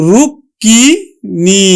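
রুক্মিণী stretched very slowly as rukkini, with a doubled k; teacher modelling রুক্মিণী; slow pronunciation demo. Shown as a demonstration. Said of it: "আরেকটি ক আমরা উচ্চারণ করব".

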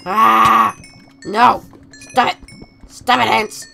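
loud, short cries under attack; shouted protest mid-fight; panicked yelling. Real, not acted. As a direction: yell at attackers to back off. Attacked by ants in game.

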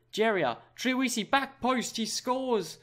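rising, thrilled play-by-play as the goal goes in; goal call. Real, not acted. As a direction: pitch climbing high into the goal shout. Goal call in live commentary.

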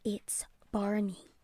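slow, voiceless whisper; silent mouthing. A narrator's reading. Lisa secretly warns her mother across the room, whispering. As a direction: whisper it slowly, barely audible. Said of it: "moved her mouth without actually speaking".

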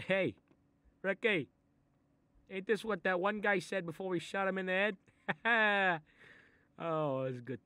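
high, loud, gleeful recollection. Acted. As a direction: relish the memory. Gleeful gangster memory.

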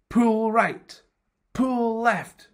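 high, projected storybook shout; urgent acted calling, pitched high; shouted orders. A narrator's reading. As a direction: call out steering commands to the rowers. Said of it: "Head shouted to Arms".

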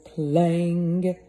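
loud clang at normal pitch; clanging bell. A narrator's reading. Narrator to listening kids, a loud bell clang. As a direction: strike it like a heavy bell.

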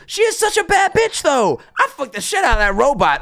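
high, excited quoted line; high mock quote of the clip; imitating the streamer. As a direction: replay the clip line in his voice. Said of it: "And then he goes".

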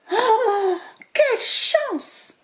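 high, slow, thrilled mouse voice; a tiny mouse gasping at her luck; delighted little mouse. Acted. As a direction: squeak it with delight at the apple. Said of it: "Se dit-elle".

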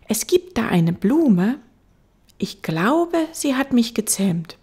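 put-on high, wondering child voice; little prince. Little prince telling the fox of his flower, high child voice.

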